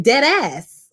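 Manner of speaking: ordinary talk, nothing marked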